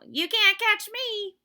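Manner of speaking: high, loud, slow taunting critter; sing-song dare from a cheeky squirrel; teasing squirrel voice. Acted. Reading the squirrel's speech bubble, high teasing voice.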